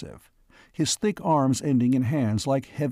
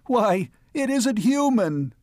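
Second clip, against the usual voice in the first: high, drawn-out cry of alarm; shrill outcry; 10 semitones higher than usual. A narrator's reading. Mayor recoiling from the furry stranger, crying shrilly. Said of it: "He cried out shrilly".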